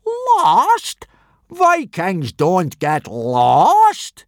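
very high, loud, slowed scoff; mock the word outright; teacher scoffing at a scared boy. A narrator's reading. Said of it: "snorted Gobber".

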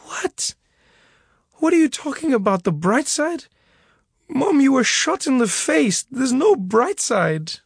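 crying son protesting to his mother; tearful disbelief. A narrator's reading. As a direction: argue through tears. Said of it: "tears streaming down my face".

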